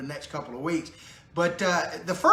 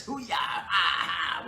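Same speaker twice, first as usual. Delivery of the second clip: whoop; 5 dB quieter, much slower than usual. Real, not acted. Whoops after his own joke.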